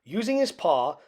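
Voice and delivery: ordinary delivery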